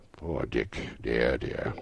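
low, soft sigh of pity; fond sorrow for an old friend; quietly wistful; tender regret. Acted. Scrooge tenderly mourns his old friend.

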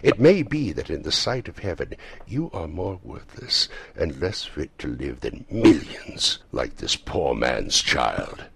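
stern, emphatic reproach with drawn-out phrases; ghost's rebuke. A narrator's reading. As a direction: rebuke him weightily, rising in force. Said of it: "the ghost's rebuke".

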